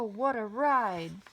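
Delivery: ordinary delivery, nothing marked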